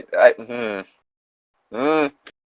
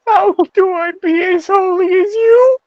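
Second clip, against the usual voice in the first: high, pleading character voice; a lightly voiced, high, earnest plea; pleading Ronald. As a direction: beg like a tearful boy. Reading Ronald's line in character.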